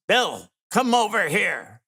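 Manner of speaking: mock yell to someone in another room; father-in-law calling him over, half-hearted yell; retold yell. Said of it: "he yells".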